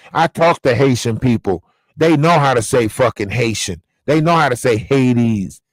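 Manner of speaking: ordinary talk, nothing marked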